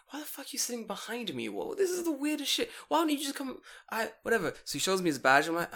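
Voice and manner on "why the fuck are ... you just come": high, incredulous complaint; ranting about a cop sitting behind him, incredulous; exasperated disbelief. Retold or imitated. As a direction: voice jumps up in outrage. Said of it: "It's like".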